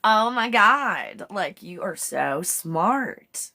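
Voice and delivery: put-on high, slow, gushing Valley Girl, ending in a breathy squeal. Vlogger playing Chat GPT gushing over a user as a Valley Girl. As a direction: drawl out the flattery like a Valley Girl. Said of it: "Mine's a Valley Girl".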